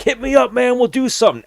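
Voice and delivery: very high mock shout; calling back while being dragged off; imitating a fighter hauled away by security; yelled goodbye.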